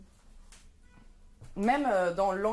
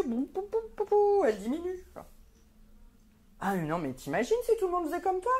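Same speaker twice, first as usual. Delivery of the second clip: nosy relative; 3 semitones higher than usual. Acted. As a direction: insist it's for her own good, pitched up. Nosy relative defending her advice.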